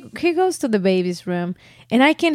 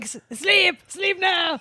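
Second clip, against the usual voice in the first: higher, soft mock command; mock yell; 9 semitones higher, 3 dB quieter, slower than usual. Child retells dad's order, half-voiced mock yell. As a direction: imitate a stern order without real volume. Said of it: "I can't believe yelling".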